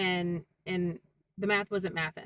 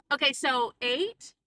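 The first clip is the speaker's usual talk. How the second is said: slow, higher mock question; condescending repeat; 5 semitones higher, 4 dB louder, slower than usual. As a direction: spell it out slowly, imitating a patronizing clerk. Mimics Mel repeating condescendingly.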